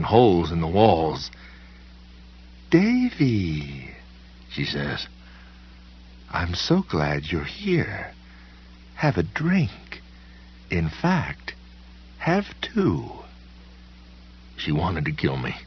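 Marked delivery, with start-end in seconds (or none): 2.7-3.9 sweet, high party-hostess greeting; gracious hostess. A narrator's reading. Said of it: "spoke as if... fancy cocktail party". 6.3-13.1 slow, high, sugary welcome; gracious hostess. A narrator's reading. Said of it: "spoke as if... fancy cocktail party".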